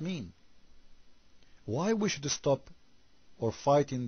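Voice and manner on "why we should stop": ordinary talk, nothing marked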